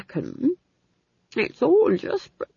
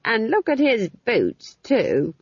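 put-on slow, higher, disapproving old woman; critically; 7 semitones higher, 3 dB louder, slower than usual. A narrator's reading. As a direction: pick fault with relish, drawing it out. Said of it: "said Nanny critically".

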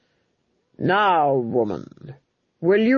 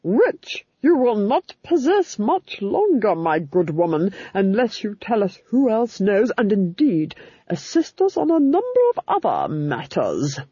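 grim, imperious Duchess drawing out her words; grim threat; 3 semitones higher than usual. A narrator's reading. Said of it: "said the Duchess grimly".

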